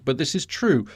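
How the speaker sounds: normal talking voice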